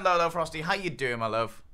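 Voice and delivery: ordinary delivery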